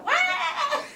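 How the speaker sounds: very high, loud "wah" holler; hollered wail. Retold or imitated. Sister retelling her little brother's bumped head, hollered wail. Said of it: "he'd holler".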